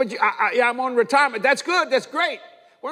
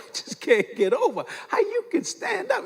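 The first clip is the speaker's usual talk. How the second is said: high-pitched, incredulous imitation; amazed disbelief; 11 semitones higher than usual. Doctor marvelling at patient standing after heart surgery, amazed. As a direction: let the voice leap up in mock astonishment. Amazed man retold to congregation.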